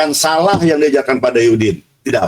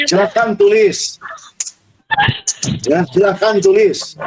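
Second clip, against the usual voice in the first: "silakan tulis" slow and pitched up; command intonation; 7 semitones higher than usual. Shown as a demonstration. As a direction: instruct the class slowly: "silakan tulis". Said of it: "Tapi intonasinya".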